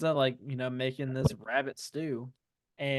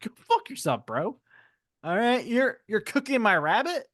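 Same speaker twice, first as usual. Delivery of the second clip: higher put-on Gollum voice; 7 semitones higher than usual. Gollum refusing Sam's request for herbs. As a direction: snarl it back as Gollum. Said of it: "And Gollum's like".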